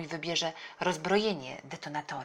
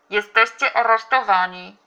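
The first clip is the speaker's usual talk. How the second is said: loud, raised official announcement; police megaphone; 4 semitones higher, 16 dB louder than usual. A narrator's reading. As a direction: proclaim an arrest over megaphone. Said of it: "zawołali policjanci przez megafon". (głośne ogłoszenie jak przez megafon)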